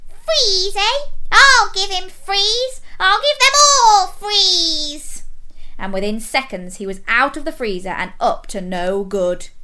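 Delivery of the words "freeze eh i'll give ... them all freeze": high, loud villain rant; slow-building squeaky fury; furious. A narrator's reading. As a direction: rage as a tiny evil pea, vowing revenge. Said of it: "The pea was furious".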